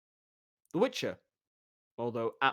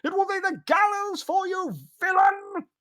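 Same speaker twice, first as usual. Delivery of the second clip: very high, loud cry; official's yell; 5 dB louder than usual. Acted. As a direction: cry for the guard. Official keeps crying for guard.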